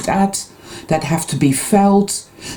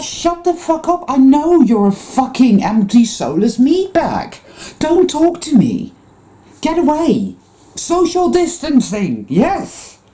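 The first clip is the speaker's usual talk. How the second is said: mock angry retort; 8 semitones higher than usual. Snapping back at fake spiritual people, high mock outrage. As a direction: send them away in a high, scornful voice.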